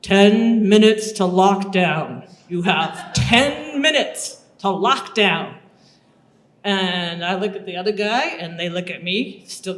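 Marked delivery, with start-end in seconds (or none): 0.0-5.6 slow, loud announcement over a loudspeaker. Retold or imitated. Camp loudspeaker telling new arrivals the time left, slow and loud. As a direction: boom it out like a PA system. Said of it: "we hear over this loudspeaker system".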